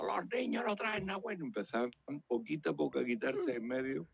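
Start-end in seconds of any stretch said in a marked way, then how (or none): 0.0-1.5 high, mock-sinister stage line; stage romancero line. A hint of the Herod romancero, performed for the crowd. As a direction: croon it like a villain on stage. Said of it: "cuando yo empezaba".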